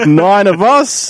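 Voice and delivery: teasing tease about nine in a room, laughing; laughing joke. Pretend. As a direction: stretch it out through laughter. Joking about sharing beds.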